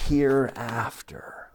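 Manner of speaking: a sudden hushed whisper on the last word. Acted. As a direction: drop to a secret whisper, like sharing a promise. Dramatic hush on a promise.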